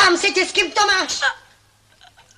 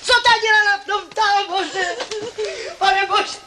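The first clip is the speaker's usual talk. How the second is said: higher, sobbing and shouting; crying; 5 semitones higher than usual. Acted. Grandmother demanding to know who the father is, crying and shouting. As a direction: shout it through tears.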